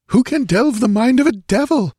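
high-pitched, fearful recounting; horrified young nobleman. A narrator's reading. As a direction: relive the horror in a high voice. Nobleman's high voice, replying to Conan.

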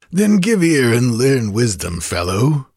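coarse, boastful tavern braggart; drunken bragging rogue. A narrator's reading. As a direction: gloat like a drunk kidnapper. Kothian lectures the stranger.

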